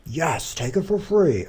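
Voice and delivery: slow, high, loud mock enthusiasm; mock-excited exclamation. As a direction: cheer the idea on, drawn out and hammed-up. Answers own musing with mock exclamation.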